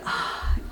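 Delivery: breathy fed-up sigh; frustrated sigh. Retold or imitated. Wife fed up after a sleepless night, sighing. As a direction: let out an exasperated sigh. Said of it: "all frustrated".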